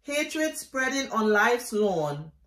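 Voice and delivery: emphatic, declaimed poetry reading; poem recitation. Acted. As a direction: recite it with deliberate weight on each image. Reads own poem aloud.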